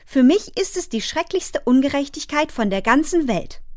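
put-on high, indignant child's outburst; passionate protest. A narrator's reading. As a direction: declare it a terrible injustice, pitch high throughout. (leidenschaftlich empört, hohe Kinderstimme)

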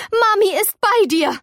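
high, urgent motherly call. Acted. Ming calls to May from another room, calling out. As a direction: call it out high to your child.

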